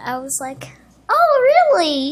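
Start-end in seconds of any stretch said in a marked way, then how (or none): 1.1-2.1 high-pitched acted politeness; squeaky attempt at acting normal; barely concealed excitement. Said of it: "I was trying to conceal it".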